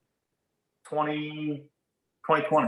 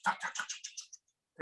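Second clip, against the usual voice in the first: soft voiceless tongue clicks; imitated camera shutter; 13 dB quieter than usual. Telling how photographers shot him, imitated camera clicks. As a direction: rattle off whispery shutter clicks.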